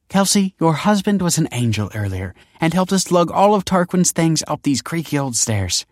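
high, loud gushing society lady; gushing mother. Acted. The other mother praises Michael to Kelsey, high gushing voice. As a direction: gush over him with exaggerated praise. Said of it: "the other mother".

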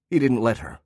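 usual speaking voice

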